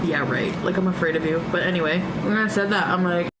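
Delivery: ordinary talk, nothing marked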